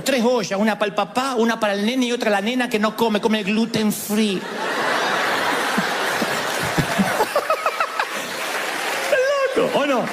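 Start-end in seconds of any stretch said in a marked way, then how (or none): 4.4-9.5 laughter. Real, not acted. Congregation laughs at gluten joke.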